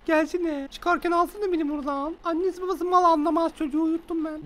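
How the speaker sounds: high, soft falsetto gossip; put-on babysitter voice. Babysitter phoning a boyfriend once baby sleeps, mock girly falsetto. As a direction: chatter secretly on the phone in a high put-on voice.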